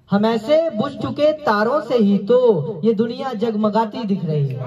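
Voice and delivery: lifted, lilting mushaira verse; poetry recitation. Acted. Recites ghazal.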